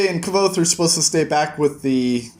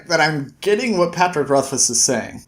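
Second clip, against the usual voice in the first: usual speaking voice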